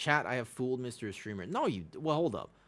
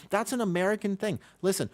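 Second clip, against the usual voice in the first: ordinary delivery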